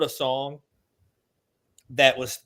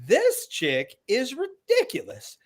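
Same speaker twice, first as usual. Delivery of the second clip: slow, higher, scoffing disbelief; mocking exasperation; 9 semitones higher, slower than usual. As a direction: drawl it out, scoffing at her. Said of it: "I was like".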